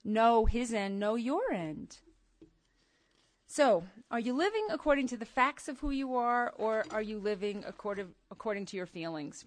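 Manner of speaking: ordinary talk, nothing marked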